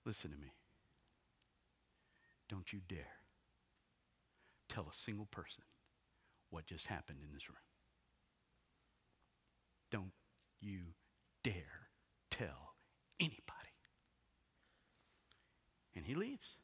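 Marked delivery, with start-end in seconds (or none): 0.0-7.6 low, quiet, deliberate warning; stern warning. Acted. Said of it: "gets in their face". 9.9-13.5 "Don't you dare tell anybody" word by word, with a pause after each word; strict charge. Acted. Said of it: "he strictly charged them".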